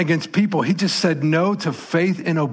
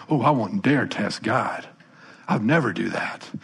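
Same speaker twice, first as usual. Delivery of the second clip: mock false piety; 4 semitones lower than usual. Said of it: "this sort of false piety".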